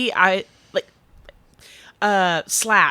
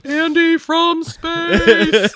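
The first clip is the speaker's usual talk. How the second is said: drawn-out announcer voice; 10 semitones higher, 5 dB louder, much slower than usual. Pretend. Joking about the show's name.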